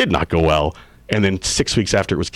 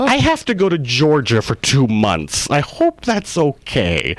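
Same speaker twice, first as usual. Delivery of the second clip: higher, slow, sheepish buttering-up; sheepish plea; 6 semitones higher, slower than usual. Retold or imitated. Husband breaking the news of leaving to his wife, sheepish. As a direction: ease the bad news in gently.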